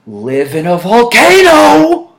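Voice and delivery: exaggerated retold rant about the heat; mock outburst. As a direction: blow up about the heat, hammed up. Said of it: "I'm like".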